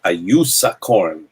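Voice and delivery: slow, savored syllables on "Ayusa Korn"; drawn-out name. Shown as a demonstration. Host showing how the name must be said, slow and drawn out. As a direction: stretch "Ayusa Korn" out slowly. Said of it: "without saying it like that".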